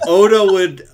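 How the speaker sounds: laughing loud and high; laughter bursting through the words; bursts out laughing. Real, not acted.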